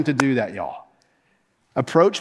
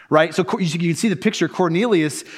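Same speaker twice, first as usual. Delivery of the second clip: normal talking voice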